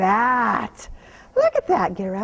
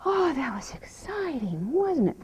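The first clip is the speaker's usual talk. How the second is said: whisper; 4 dB quieter than usual. Real, not acted. Soothing horse after exercise.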